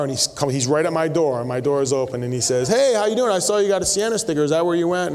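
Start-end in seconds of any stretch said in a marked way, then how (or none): 2.7-5.1 higher-pitched chatty stranger's greeting; bubbly gentleman. Retold or imitated. Bubbly man greets the pastor at his car door, chatty and high. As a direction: imitate a bubbly man striking up a chat. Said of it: "this real bubbly gentleman".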